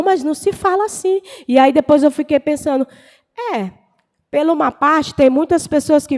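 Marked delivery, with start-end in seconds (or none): 0.0-1.2 higher-pitched, insistent reproof; retold scolding. Church sister still objecting to her praying, mock reproof in a raised pitch. The same rebuke acted again.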